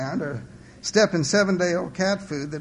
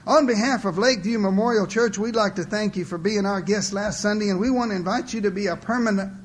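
higher, louder canned welcome speech; tape-recorder recitation; 5 semitones higher, 4 dB louder than usual. A narrator's reading. Old man giving his visitation speech, rote recitation. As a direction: rattle off a memorized church greeting. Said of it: "It was kinda like a tape recorder".

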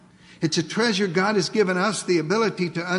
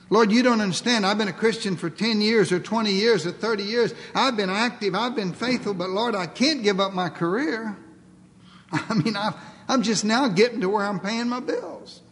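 higher-pitched, pleading excuses; mock-indignant believer making excuses; acted protest; 4 semitones higher than usual. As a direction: plead your case to the Lord.

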